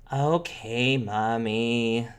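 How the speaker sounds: put-on mildly grudging kid obedience; reluctant, lightly sulky child; eye-rolling child. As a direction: give in to mom, drawn out. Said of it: "rolls her eyes a little bit".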